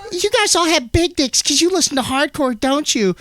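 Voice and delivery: high mock-scolding woman's voice; hammed-up high accusation; mad lady. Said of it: "that one lady that got mad".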